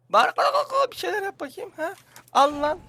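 mock the monster in a squeaky voice; mocking squeal. Real, not acted. Düşmanla alay ediyor. (düşmanla ince sesle alay etme)

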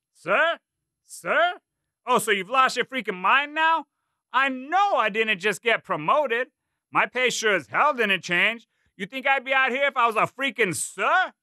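high, loud drill sergeant bark; drill sergeant yelling. A narrator's reading. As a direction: bark it like a gunny. Drill sergeant mocking cadet.

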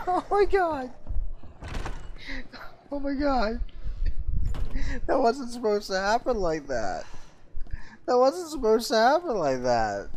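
high-pitched, squeaky wail after a laughing fit; helpless laughter. Real, not acted. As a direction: protest weakly in a high voice, cracking up. Still helpless after game mishap.

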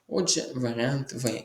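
ordinary delivery

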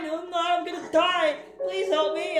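hammed-up frightened hero pleading; panicky Link. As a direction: play the hero begging for help.

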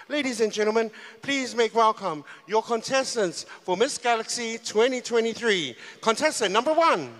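loud, rising stage announcer call; pageant announcer. Acted. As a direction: hype up the crowd like an MC. Pageant host calling contestants.

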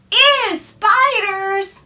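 disgusted. Acted. Girl recoils from spiders.

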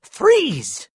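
high, sudden yell; high-pitched urgent command. A narrator's reading. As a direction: call out a sharp order to stop. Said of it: "then turned and yelled".

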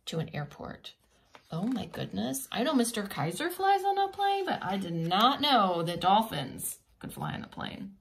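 ordinary delivery

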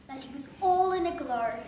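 ordinary delivery

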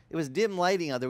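ordinary talk, nothing marked

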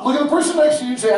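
loud, high, fast call to a room; rally the whole class over the chatter; calling out instructions. Real, not acted.

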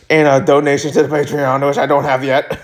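ordinary delivery

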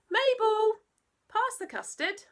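high, loud bluster; calm everyone down in a fluster; dad's flustered reassurance; fussy dad. Acted. Dad calls to Mabel.

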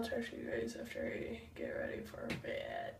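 soft unvoiced whisper. Real, not acted. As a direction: whisper it late at night. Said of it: "I talk like this".

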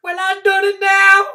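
put-on old country woman. Trotter voice.

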